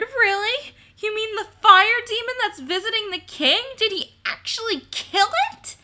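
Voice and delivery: high, excited flurry of questions; clamoring. A narrator's reading. Kobolds question Peter about the fire demon, clamoring. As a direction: pitch it way up in amazed excitement. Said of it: "they clamored".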